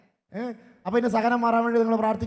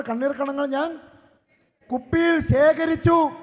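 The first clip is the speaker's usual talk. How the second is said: raised, deliberate scripture preaching; 6 semitones higher, 7 dB louder than usual. Acted. Priest expounding the psalm to the congregation, raised and deliberate. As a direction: lean into each word of the verse.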